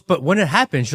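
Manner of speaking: normal talking voice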